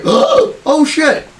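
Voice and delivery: high startled yelp, oh shit; panicked yelp. Retold or imitated. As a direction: squeak it out in sudden panic. Voicing panicked aliens.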